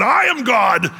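a big loud proclamation, head thrown back; proclaiming shout. Retold or imitated. Preacher caught up in his sermon, shouting. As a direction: shout it out like a carried-away preacher.

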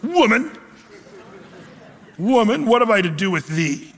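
high, sharp rude retort, half laughing; mock-rude son brushing off his mother; harsh reading. Shown as a demonstration. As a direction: snap it at his mother like a rebuke. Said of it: "how do you read this? Was it".

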